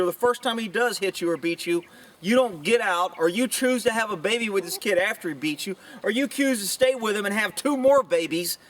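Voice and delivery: ordinary talk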